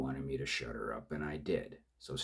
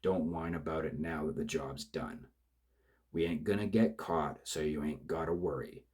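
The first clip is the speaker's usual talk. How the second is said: louder, rough threatening rant; bully him into silence; angry thug; 7 dB louder than usual. Acted. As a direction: killer yelling at his crying partner to shut up. Killer shouts down partner.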